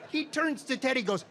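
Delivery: normal talking voice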